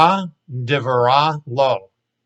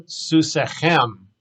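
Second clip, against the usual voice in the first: ordinary delivery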